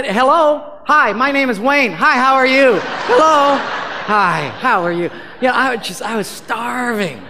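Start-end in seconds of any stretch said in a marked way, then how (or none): 0.0-5.1 much higher and louder, bursting with joy; overjoyed greetings. Retold or imitated. Escaped preacher greeting strangers in the internet cafe, ecstatic. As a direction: greet every stranger like a long-lost friend.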